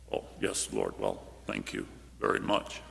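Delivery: soft, breathless, prissy thank-you; prim polite reply. Retold or imitated. Preacher mocking disciples' prim thanks, hushed and breathy. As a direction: whisper it like timid churchgoers.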